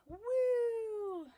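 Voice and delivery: a high, loud whoop of amazement; excited whoop. Real, not acted. After reading the twist, a loud excited woo. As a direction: let out a big woo, mind blown. Mind blown by the story.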